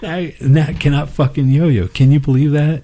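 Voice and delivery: loud. Real, not acted. Teasing reveal, raised voice.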